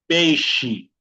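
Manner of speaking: "Peixe" said "pêi-shi". Shown as a demonstration. Teacher giving an example word, "Peixe" with a sh sound. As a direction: hush the X in "Peixe". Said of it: "el sonido del silencio".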